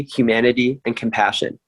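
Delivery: ordinary delivery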